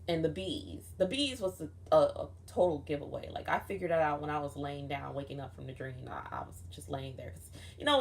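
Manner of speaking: usual speaking voice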